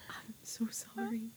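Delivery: hushed sympathetic whisper. Retold or imitated. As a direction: breathe it like a secret condolence. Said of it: "And I was like".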